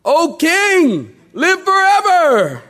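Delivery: high, loud, drawn-out hail; calling out. Acted. Daniel hailing the king from the den, calling out. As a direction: holler it up from far below.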